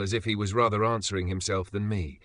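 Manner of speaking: normal talking voice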